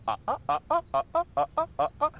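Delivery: high, rapid fire goose calls. Retold or imitated. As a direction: fire off quick high ahs like geese. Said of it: "that rapid fire sound of".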